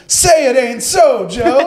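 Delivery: high, slow pleading cry; mock heartbroken plea, high and slow; heartbroken kid. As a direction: beg him like a crushed young fan. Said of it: "That was the heartbreak in his voice".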